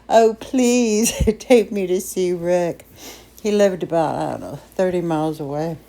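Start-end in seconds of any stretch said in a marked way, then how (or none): 0.1-2.8 put-on slow, drawn-out teenage begging; hammed-up plea with a little laugh; pleading. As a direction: beg Mom in a put-on pleading voice. Teenager begging her mother.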